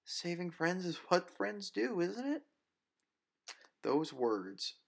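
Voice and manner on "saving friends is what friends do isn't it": high, lilting friendly question; warm teasing. Acted. Grace warmly reassures her friend. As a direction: smile through tears.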